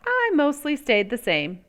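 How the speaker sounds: ordinary delivery, nothing marked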